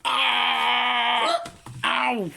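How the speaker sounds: high shout of pain; pained yelp. Acted. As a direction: cry out as if struck. Hit by something, in pain.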